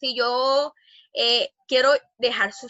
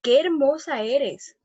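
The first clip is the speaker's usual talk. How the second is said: slow, higher, louder cry of admiration; admiring exclamation; 4 semitones higher, 4 dB louder, slower than usual. Shown as a demonstration. Teacher showing students an exclamation, slow and admiring. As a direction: draw it out as if struck by beauty. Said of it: "Yo estoy exclamando".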